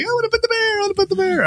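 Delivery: put-on high whiny tantrum voice of a toddler; mad little girl. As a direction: wail it like a furious toddler being carried off. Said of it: "And she gets so mad".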